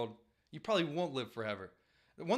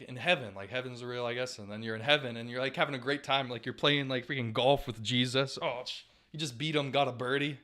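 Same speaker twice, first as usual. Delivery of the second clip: ordinary talk, nothing marked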